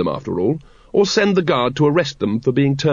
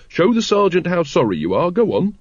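raised, sharp urging; 6 semitones higher than usual. A narrator's reading. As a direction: prod someone sharply, pitched higher. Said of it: "said Carrot sharply".

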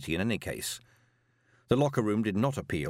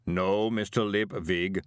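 slow, measured golem voice; placid golem; slower than usual. Acted. Golem correcting Moist, placid and slow. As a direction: answer ponderously, evenly weighted.

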